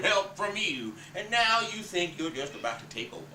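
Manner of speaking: a dying father crushing his wife's takeover, furious; Big Daddy monologue. Acted. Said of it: "Big Daddy comes back".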